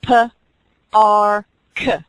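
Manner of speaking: "P, R, K" said as separate sounds; segmenting sounds. Shown as a demonstration. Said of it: "How many sounds are in park?".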